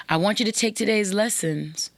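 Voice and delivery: ordinary talk, nothing marked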